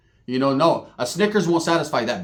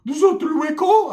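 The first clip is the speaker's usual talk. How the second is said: squeaky high cartoon-mouse cousin; Mickey Mouse voice; 11 semitones higher than usual. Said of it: "put the Mickey Mouse to it".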